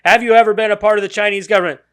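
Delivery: hammed-up congressional grilling; mock senator grilling. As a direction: rattle it off like a pushy old senator. Mocking senator's hearing question.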